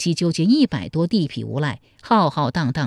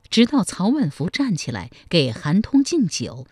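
plain reading voice

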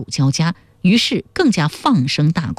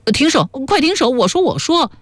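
high-pitched begging, acted without real volume; desperate pleading; 9 semitones higher than usual. A narrator's reading. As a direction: plead in a high voice as a tormented captive. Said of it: "终于抵受不住那种酥麻入骨的折磨".

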